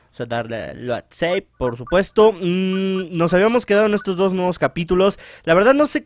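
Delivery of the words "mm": normal talking voice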